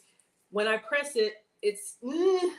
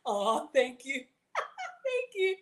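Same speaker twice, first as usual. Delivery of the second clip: thanks through real laughter, the second one much higher; laughing thanks; 5 semitones higher, much slower than usual. Real, not acted. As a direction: laugh out a grateful thank you.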